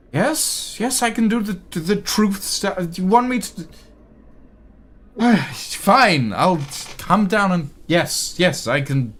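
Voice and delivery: a put-upon clerk fed up with demands; an overworked elf snapping back at the councilman; fussy elf scribe. Acted. Elna answers, sighing, grudging.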